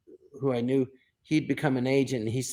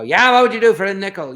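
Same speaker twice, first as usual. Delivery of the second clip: loud, raised-pitch comic taunt; loud challenging retort; 6 semitones higher, 9 dB louder than usual. Retold or imitated. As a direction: fire back loudly, mock confrontational. Acting out their improvised confrontation bit.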